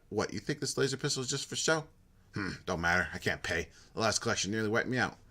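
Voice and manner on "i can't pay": ordinary talk, nothing marked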